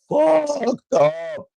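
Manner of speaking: exclaimed. Real, not acted. Exclaiming about dad's high.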